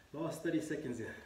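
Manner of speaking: usual speaking voice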